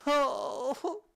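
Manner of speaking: high, loud wailing sob; tearful wail then a choked sob; sobbing wail. Acted. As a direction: let out a howling cry with a teary gasp. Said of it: "go ahead".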